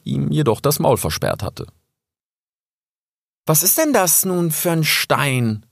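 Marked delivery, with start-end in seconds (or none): 3.4-5.6 much higher, slow curious cub; young fox asking the raven about the stone. Acted. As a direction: wonder aloud, eager to know. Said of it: "fragte er schließlich neugierig".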